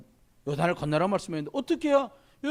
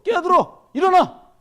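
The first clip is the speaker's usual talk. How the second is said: much higher, much louder shout to wake up; 11 semitones higher, 11 dB louder, slower than usual. Retold or imitated. Pastor yelling at a sleeping teen to get up, loud shout. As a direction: bark them out of sleep. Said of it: "어떤 애는 또 자요".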